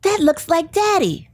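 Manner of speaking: a hint of an excited little kid pointing; young son piping up on the plane, mildly childlike; five-year-old son. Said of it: "he says".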